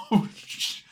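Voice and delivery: breathless shocked whisper; awed whisper cut short; stunned whisper. Retold or imitated. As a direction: gasp it out in awe. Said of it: "And I'm like".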